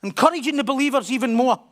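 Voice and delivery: loud and high, pressing each word; emphatic preaching. Real, not acted. Preacher urging the listeners, loud and high. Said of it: "preaching emphasis".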